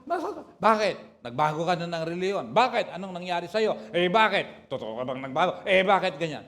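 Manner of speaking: loud, mock-angry family questioning; mock scolding. Family scolding the new convert, loud mock anger.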